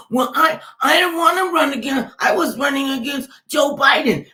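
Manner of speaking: high, loud mocking shout of Trump's words. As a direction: yell his excuse back in a hammed-up voice.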